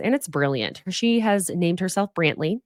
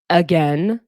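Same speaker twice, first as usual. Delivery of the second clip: mock disbelief; 5 dB louder than usual. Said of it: "Danielle just goes".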